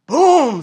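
loud imitated boom of a slamming gate; gate slam. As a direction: bang it out like a metal gate. Gate slams shut.

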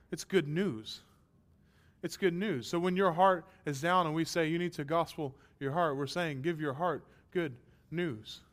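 ordinary talk

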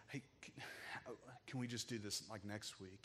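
soft, hesitant pleading; hushed plea. Retold or imitated. Dad softly asking the doctor to wait until next week. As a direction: quietly beg to put it off.

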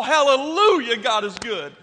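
mock jubilant praise. Friend boasting of answered prayer, mock jubilant. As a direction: burst out in exaggerated church praise. Friend's triumphant story climax, acted.

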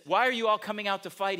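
loud, mocking giant calling across the valley; a taunting champion's shout. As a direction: bellow the challenge at the army. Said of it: "shouted a taunt".

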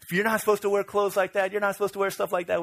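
high-pitched mock scolding; retold mocking. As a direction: imitate boys ribbing a friend. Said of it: "We'd make fun of him".

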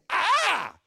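high, loud mock shriek; sudden high yelp of mock fright; imitated scream. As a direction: imitate a passenger screaming in turbulence. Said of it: "she screamed again".

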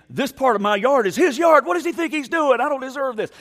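high, loud mock outrage; indignant neighbor. Pastor voicing a neighbor who thinks he's wronged, mock indignant.

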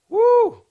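loud high woo; excited whoop. Real, not acted. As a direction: let out a big whoop.

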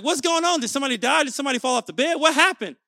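hammed-up urgent questioning; mock alarm. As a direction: act out a dad reacting to an emergency. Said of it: "Like, it's an emergency, y'all. Like, I'm like".

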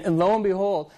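ordinary talk, nothing marked

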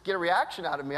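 ordinary talk

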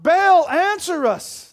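high, loud, drawn-out call; shouted invocation. A narrator's reading. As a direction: cry out to a distant god, stretching each word. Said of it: "they shouted".

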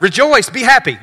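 bright, loud call to rejoice; joyful rejoice. Shown as a demonstration. Preacher voicing the angel's joyful greeting, loud. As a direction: shout the joy out. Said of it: "it's literally like".

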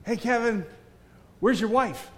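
lightly mimicked high-pitched call; imitated eager askers. Imitates people asking after his wife.

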